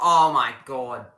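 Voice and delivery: loud, drawn-out disbelief; exasperated oh my God. Real, not acted. Streamer reacting to a chat answer, loud and drawn out. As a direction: groan it out in disbelief.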